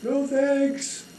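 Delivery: high, light no-thanks; breezy refusal. Acted. Dangling man refusing the holder, high and breezy. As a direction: brush it off airily.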